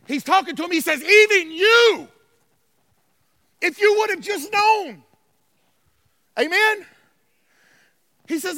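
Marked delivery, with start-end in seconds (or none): 1.0-5.0 high, loud, anguished reproach; impassioned lament. Retold or imitated. Preacher as Jesus addressing Jerusalem, impassioned cry. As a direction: cry it out over a city that will not listen.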